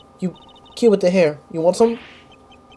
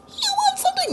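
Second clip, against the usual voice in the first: You want something? squeaked far higher; squeaky high voice; 6 dB quieter than usual. Shown as a demonstration. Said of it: "No, I'm not doing that again".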